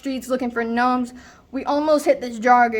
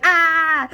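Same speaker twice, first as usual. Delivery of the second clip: higher, louder acted scream; mock scream; 5 semitones higher, 4 dB louder than usual. Crazy friend rushing the gnomes, mock screaming. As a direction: imitate a wild charging yell.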